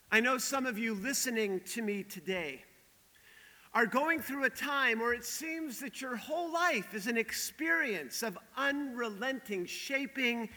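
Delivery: normal talking voice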